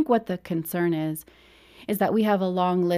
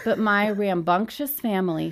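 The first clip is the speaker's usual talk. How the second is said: normal talking voice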